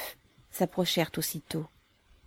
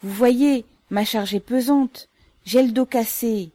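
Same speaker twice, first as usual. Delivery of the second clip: complaining plea; 6 semitones higher, 8 dB louder than usual. A narrator's reading. Burdened mother pleads with guards.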